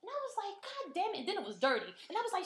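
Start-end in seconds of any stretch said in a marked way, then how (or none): 0.6-1.2 high-pitched exasperated outburst; exasperated cry, acting it out; retold anger. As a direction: retell your outrage, voice jumping high. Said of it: "I was mad as shit".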